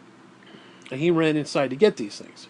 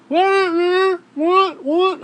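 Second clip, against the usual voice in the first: high squeaky mocking what; mock dim friend; 7 dB louder, much slower than usual. Dale T not hearing where to go, high squeaky mockery. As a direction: play the clueless buddy in a high silly voice.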